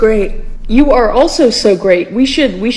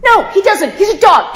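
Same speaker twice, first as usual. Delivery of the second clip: high, laughing shout of protest; exasperated high shout; 10 semitones higher than usual. Acted. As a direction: yell it at him, half laughing. Arguing that dogs can't talk.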